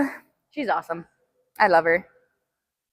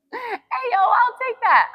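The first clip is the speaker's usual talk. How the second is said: high excited little whoop; excited cheer; 9 semitones higher, slower than usual. Real, not acted. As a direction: celebrate the win. Celebrating after set.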